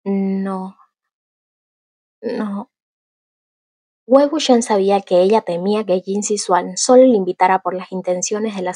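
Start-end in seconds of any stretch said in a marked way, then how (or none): 0.0-2.7 soft, hushed repeated no; timid hushed no; whispered refusal. Acted. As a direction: barely voice the denial, much softer. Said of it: "Yan Yanli susurró".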